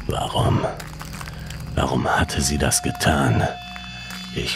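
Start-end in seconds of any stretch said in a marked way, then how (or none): none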